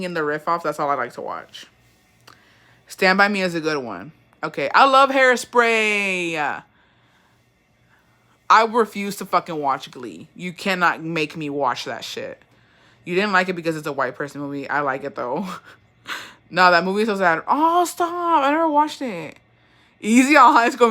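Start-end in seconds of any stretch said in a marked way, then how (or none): 4.7-6.6 long drawn-out gush; very slow, emphatic fan declaration; gushing enthusiasm. Real, not acted. Gushing over a favourite film. 17.5-20.9 high "oh, stop" wail; pleading protest. Real, not acted. Protesting a comment about sad film.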